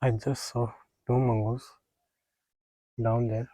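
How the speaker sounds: usual speaking voice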